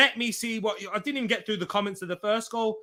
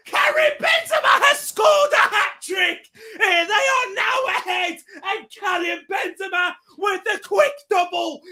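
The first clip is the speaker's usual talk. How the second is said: screaming with real joy, way louder and higher; ecstatic goal shout; 8 semitones higher, 6 dB louder than usual. Real, not acted. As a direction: roar the goal like a fan losing it. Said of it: "Apologies for the noise".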